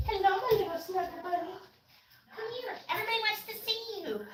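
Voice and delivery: high, soft, quick pet voice; squeaky little voice for the snuggle bunny. Real, not acted. Cooing to her puppy.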